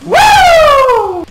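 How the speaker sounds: high, loud celebratory woo; excited whoop. Real, not acted. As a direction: let out a big hype-man whoop. Hyping up the main event.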